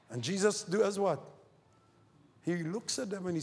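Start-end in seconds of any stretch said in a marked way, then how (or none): none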